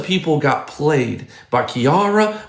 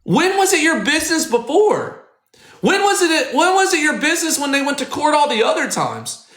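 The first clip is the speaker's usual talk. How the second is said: heated high rant; 12 semitones higher than usual. Real, not acted. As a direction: pitch shoots up, sharp and indignant. Outraged rant.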